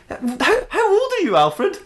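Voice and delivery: very high, stammering disbelief; baffled, pitch shooting up; incredulous question. Acted. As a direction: squeak out the question in amazement. Batman baffled by butler's age.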